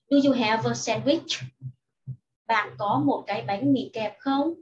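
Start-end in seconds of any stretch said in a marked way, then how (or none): 0.1-1.4 "Do you have a sandwich" run together, "have-a" joined; linked quick reading. Shown as a demonstration. Teacher shows pupils linked reading of the question, quicker and joined. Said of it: "cô đọc nối như sau".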